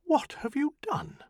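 high, shocked question; stunned disbelief; high shocked gasp of a question; dumbfounded. Acted. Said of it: "she gazed at him dumbfounded".

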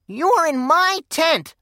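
put-on high, slow squeaky boy's protest; squeaked. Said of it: "squeaked Clarence".

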